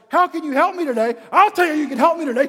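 high, very loud irate caller; angry outburst. Acted. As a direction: unload pent-up anger on the agent. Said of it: "unload on this person all the anger".

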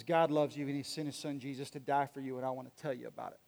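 low, soft, earnest address; acted calm, hushed reassurance; gentle sincere appeal. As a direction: speak softly to a hostile crowd. Acts bloodied Paul gently addressing crowd.

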